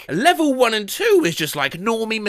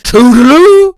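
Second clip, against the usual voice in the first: a loud sing-song goodbye; 8 semitones higher, 14 dB louder than usual. Retold or imitated. Loud woman in car.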